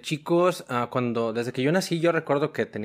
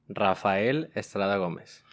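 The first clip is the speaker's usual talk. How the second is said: ordinary talk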